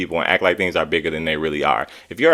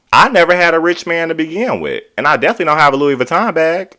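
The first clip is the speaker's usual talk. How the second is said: higher, louder sassy aside; sassy outburst; 9 semitones higher, 5 dB louder, faster than usual. Real, not acted. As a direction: throw shade, up high.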